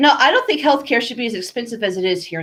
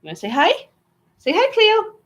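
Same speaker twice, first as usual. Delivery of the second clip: high, sing-song urging; pet talk; 9 semitones higher than usual. Real, not acted. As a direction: coax the dog to say hello. Urging pet to greet viewers.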